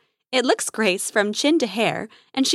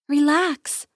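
higher, soothing sing-song; sing-songy; 4 semitones higher than usual. A narrator's reading. Grace calms the injured hexacorn, sing-songy. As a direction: lilt it to settle a hurt animal. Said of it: "in a sing-songy voice".

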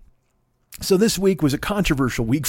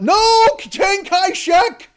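high, drawn-out mock scream; 6 dB louder, much slower than usual. Care-home resident suddenly shouting in the middle of TV chat, screaming. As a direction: scream it out like a confused old patient. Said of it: "they just scream out".

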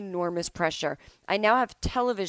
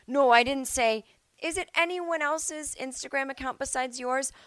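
mock judge; 7 semitones higher than usual. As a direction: cut him off and press. Judge voiced pressing.

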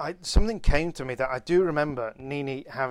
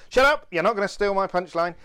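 loud, high outburst, easing slightly; raised-voice protest at an interrupter; shouting down; 6 semitones higher, 6 dB louder than usual. Real, not acted. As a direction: silence a friend to guard your punchline. Silencing friend to protect punchline.

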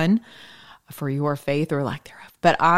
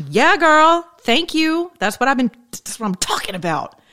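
playful God voice; 4 semitones higher than usual. Pretend. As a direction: cheer her on as an excited sassy God. Voicing God cheering her on.

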